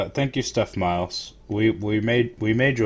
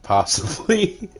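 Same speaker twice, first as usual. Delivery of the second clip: mocking; 11 semitones higher, 3 dB louder, much slower than usual. Mocking echo of partner.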